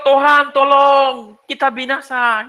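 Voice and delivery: a frightened fisherman begging for rescue; Peter's panicked cry. Retold or imitated. Peter crying to Jesus as the boat nearly sinks, imitated panic. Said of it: "Mungkin Petrus bilang".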